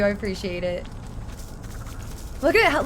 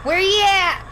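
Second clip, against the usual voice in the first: 1 louder higher call; 6 semitones higher, 4 dB louder than usual. Pretend. Calling out for hiding victims.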